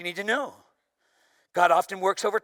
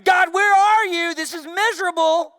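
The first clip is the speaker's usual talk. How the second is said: loud, slowed, desperate cry to God; shouting at God; 10 semitones higher, 10 dB louder than usual. Retold or imitated. Preacher retelling his lonely morning, shouting to God. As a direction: cry out to God, where are you. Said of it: "I'm over there screaming".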